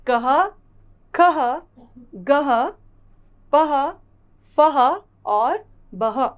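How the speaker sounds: कह, खह, गह, पह, फह called loud, बह slow; recap drill. Shown as a demonstration. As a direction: run the list for children to repeat. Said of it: "यह सारे शब्द हैं हमारे अह की मात्रा से". (कह खह गह पह फह बह, ज़ोर से दोहराना)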